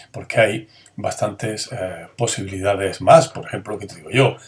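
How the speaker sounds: ordinary delivery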